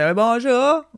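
high, chirpy put-on phone greeting; high phone-operator bonjour; sing-song phone hello. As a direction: greet the caller in a bright fake-polite voice.

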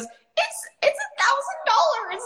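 put-on tiny, very high squeak, like a child; voice shooting up. Uncomfortable seller naming her price on a call, childlike high squeak. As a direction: squeak out the price in mock discomfort. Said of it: "Do you notice that your voice goes".

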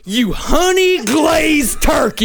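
shouted southern accent. Shown as a demonstration. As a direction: yell it like an angry southern local. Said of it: "It's all about the attitude".

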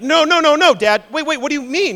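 loud, high-pitched panicked protest; acted dismay, jumping up in pitch; alarmed. Esau hears his blessing was stolen, alarmed.

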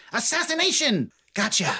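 high-pitched gleeful exclamation; triumphant gotcha, high and playful; playful ambush cry. Acted. Playful ambush by voiced halfling.

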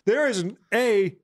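normal talking voice

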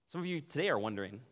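usual speaking voice

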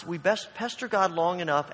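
ordinary delivery

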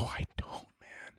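barely voiced, defeated reply; helpless whisper. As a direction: whisper it as if given up. Helpless answer to the doctor.

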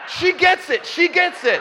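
high, loud burst of vindication; excited shout. Retold or imitated. Preacher reacting to the woman's remark, high excited shout. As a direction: shout it out, thrilled someone understood.

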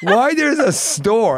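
loud, high, drawn-out disbelief; mock bafflement. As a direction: exclaim in exaggerated amazement. Acted baffled reaction to shop.

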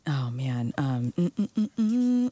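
usual speaking voice

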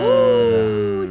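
high, loud, rising "ooh"; a party-crowd ooh; excited ooh. Real, not acted. Co-host reacting to a wild show story, excited.

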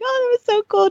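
high-pitched mock gush; retold gushing. Mom retelling chit-chat after her son's birthday call, high gushing. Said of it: "We were like".

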